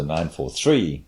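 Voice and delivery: "nine four three" with a heavy accent on "three"; emphasis on last word. Shown as a demonstration. Demonstrating the emphasis condition for the listener. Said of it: "put a big emphasis on the last word".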